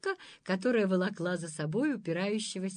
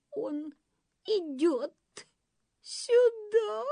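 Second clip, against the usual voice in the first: much higher, tearful sobbed warning; sobbing; 13 semitones higher, slower than usual. Acted. False lady sobbing to the monster, high tearful voice. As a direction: sob out the warning. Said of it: "всхлипнула притворная дама".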